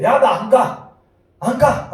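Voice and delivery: loud, high-pitched digging in of heels; sulky refusal. Acted. Preacher acting out God refusing to go, loud sulky refusal. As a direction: refuse like someone refusing to budge.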